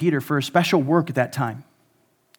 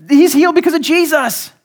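healed man; 9 semitones higher, 6 dB louder than usual. Retold or imitated. Healed man answering the leaders.

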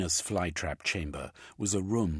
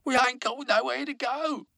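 complaining worker; 8 dB louder than usual. A narrator's reading.

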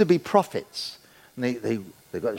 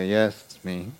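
put-on lower, understated answer to his girlfriend; mock deep reply; 6 semitones lower, 4 dB quieter than usual. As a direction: drop the voice, calm and pleased. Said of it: "I go".

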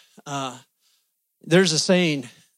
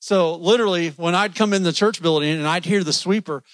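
ordinary talk, nothing marked

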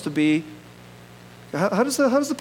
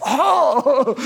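excited gasp; 6 semitones higher, 4 dB louder than usual. Retold or imitated. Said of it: "I go".

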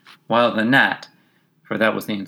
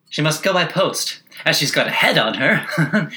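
passenger voice; 6 semitones higher than usual. A narrator's reading.

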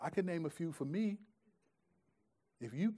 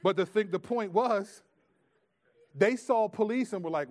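ordinary talk

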